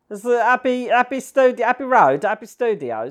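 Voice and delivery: high, loud gushing excitement; excited. Real, not acted. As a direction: gush with delight. Excited exclamation, much higher louder.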